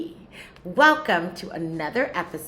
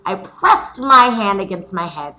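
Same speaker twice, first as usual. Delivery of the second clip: louder, lively storytelling voice; story reading to children; 11 dB louder than usual. A narrator's reading. Teacher reading chapter four to her scholars, lively. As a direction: read it out like a picture book for kids. Reads the story aloud to class.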